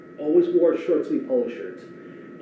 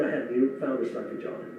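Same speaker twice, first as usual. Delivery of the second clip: ordinary delivery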